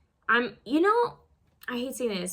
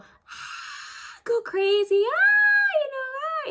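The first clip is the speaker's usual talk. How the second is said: high, loud mock fan screams; crowd screaming; 5 dB louder, much slower than usual. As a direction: squeal like a crowd of screaming fans. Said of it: "people go".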